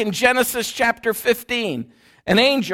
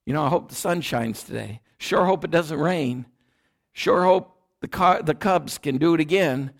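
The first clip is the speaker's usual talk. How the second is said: casual everyday hope; 6 semitones lower, 5 dB quieter than usual. Retold or imitated. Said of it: "the way we use it today".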